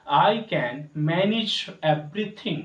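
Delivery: very slow and clear; slow teacher model; slow model sentence. Shown as a demonstration. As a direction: say it word by word for learners. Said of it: "manage को manage पढ़ेंगे".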